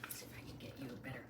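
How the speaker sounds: fast soft whisper. Real, not acted. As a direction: whisper it quickly to the puppy. Coaxing puppy quietly.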